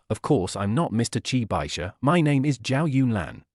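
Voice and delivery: plain reading voice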